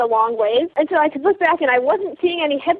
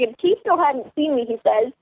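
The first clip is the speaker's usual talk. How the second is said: normal talking voice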